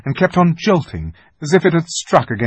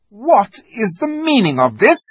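higher, louder, icy demand; haughty witch queen question; 10 semitones higher, 6 dB louder than usual. Acted. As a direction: interrogate the creatures like a cruel queen. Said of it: "asked the witch queen".

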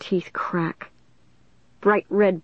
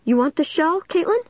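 tight shaky voice; 10 semitones higher than usual. A narrator's reading. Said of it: "I asked in a tight, shaky voice".

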